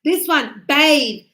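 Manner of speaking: ordinary talk, nothing marked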